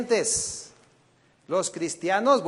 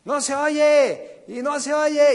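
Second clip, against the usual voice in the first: higher, louder, slow crowd-pumping demand; preacher imitating a motivator demanding more noise, higher and louder; mock hype shout; 8 semitones higher, 5 dB louder than usual. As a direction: egg the crowd on to yell. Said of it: "Y luego dicen".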